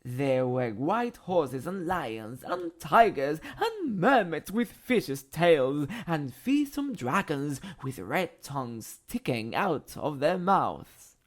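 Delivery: plain reading voice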